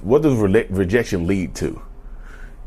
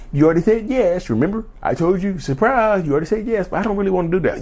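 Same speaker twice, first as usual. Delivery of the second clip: higher, coaxing manipulator voice; mock manipulator; 8 semitones higher than usual. Mimicking a narcissist trapping you into yes, higher. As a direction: put on a sly, pushy persuader.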